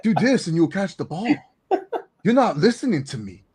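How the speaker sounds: loud, high, exasperated mock coaching; wrestler's coaching rant. Wrestler teaching a receiver to catch, loud mock exasperation. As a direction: bark the drill like a fed-up wrestler. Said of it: "If he just be like".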